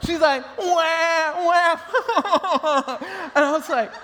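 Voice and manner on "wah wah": high, imitated fake wailing; mocking baby cry. Two-year-old mocking her crying baby sister, fake wail. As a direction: make fun of a crying baby with high wahs. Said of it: "she started making fun of her".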